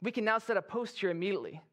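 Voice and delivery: proud, excited soldier calling it in; elated soldier. Acted. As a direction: report the victory to your officer, thrilled. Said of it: "soldier is feeling really good".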